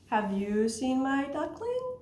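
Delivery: slow question climbing high; mother duck asking. Acted. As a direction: stretch it out, rising to the end. Mother duck asks again.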